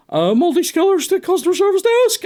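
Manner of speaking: high, nasal-free mock PA call; mock supermarket intercom call; tannoy announcement. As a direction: announce it like the store speaker. Mimicking the store tannoy announcement.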